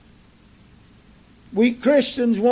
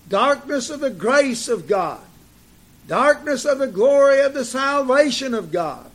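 emphatic preaching; 3 semitones higher than usual. Real, not acted. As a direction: drive each repeated phrase home to the congregation. Preaches on being called from darkness.

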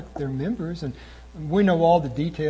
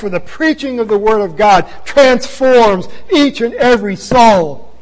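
high, loud, impassioned preaching; fiery preaching; 7 semitones higher, 11 dB louder than usual. Acted. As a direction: preach it with fire to the pews. Preaching rises to full force.

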